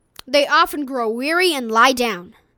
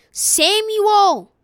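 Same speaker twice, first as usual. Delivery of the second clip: louder, higher narrated cry; calling out; 6 semitones higher, 5 dB louder than usual. A narrator's reading. Voice calls Samuel a second time, crying out. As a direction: call out the name to rouse a sleeper. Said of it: "the voice cried".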